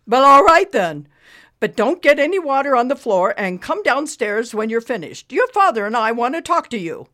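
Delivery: loud, stern mother through door; a stern mom calling in. Acted.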